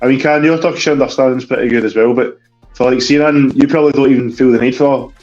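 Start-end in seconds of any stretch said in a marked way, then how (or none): none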